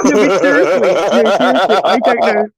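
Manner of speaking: protesting through laughter; laughing. Real, not acted. Woman teased about boyfriend's age, laughing protest. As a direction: laugh while trying to be serious.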